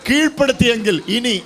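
loud, shouted declaration; full-force preaching. Acted. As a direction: shout it to rouse the congregation.

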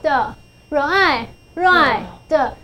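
"R I" slowly apart, then "right的" with a separate final d; sounding out. Shown as a demonstration. Teacher joining consonants to long I, slow sounding out. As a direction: stretch "R I，right的", adding "的" as the d sound. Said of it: "所以这个字就念作right".

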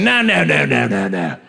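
tumbling string of low noes; Australian TSA agent. Acted. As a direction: stop someone in a put-on Aussie agent's voice. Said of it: "The T S A agent".

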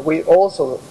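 ordinary talk, nothing marked

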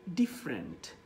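"ডিফারেন্ট" as DIF-rent, falling; two syllables only in "ডিফারেন্ট"; correct pronunciation. Shown as a demonstration. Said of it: "এটা হবে হচ্ছে".

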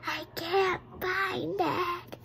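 put-on baby bear whispering to his parents, upset. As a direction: whisper it, close to crying over the lost book. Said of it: "he was starting whispering".

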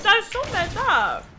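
high, slow outraged whine; indignant. Real, not acted. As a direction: complain it wasn't fair. Indignant complaint.

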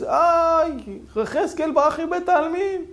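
high, loud alarmed cry; panicked shouting. Acted. Beadle running outside, crying out in panic. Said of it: "מתחיל לצעוק בחוץ".

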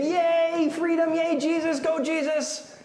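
high, excited mock cheer; crowd cheering. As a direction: imitate a jubilant crowd cheering him on. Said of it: "where everybody's thinking".

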